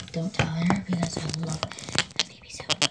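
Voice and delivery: ordinary delivery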